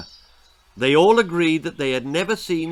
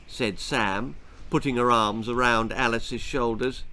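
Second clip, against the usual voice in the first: ordinary delivery, nothing marked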